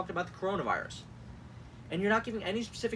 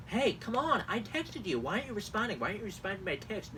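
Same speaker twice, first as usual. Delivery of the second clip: higher, whiny pestering voice; jealous ex-boyfriend; 5 semitones higher than usual. Acted. Host mocks campaign texts as a jealous ex-boyfriend. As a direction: plead like a needy ex. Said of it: "jealous ex-boyfriends who want senpai to notice".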